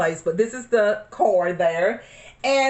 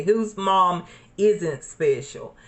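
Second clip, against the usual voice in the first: ordinary delivery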